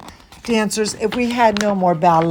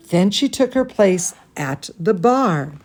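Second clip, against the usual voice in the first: plain reading voice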